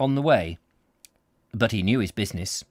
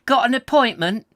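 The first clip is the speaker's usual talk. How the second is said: higher and louder, slow drawled Got; husky receptionist; 12 semitones higher, 6 dB louder than usual. Acted. Receptionist greeting a visitor, slow husky drawl. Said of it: "she asked in a slow, husky".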